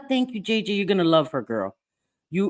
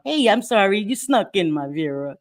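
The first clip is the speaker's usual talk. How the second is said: ordinary talk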